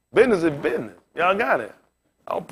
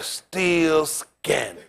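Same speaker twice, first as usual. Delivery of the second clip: slow drawn-out verdict; 4 dB quieter, much slower than usual. Acted. Punchline repeated slowly.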